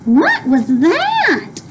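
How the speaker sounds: high, very slow startled question; boy startled by a scream. Acted. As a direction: gasp it out. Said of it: "said William Spiver".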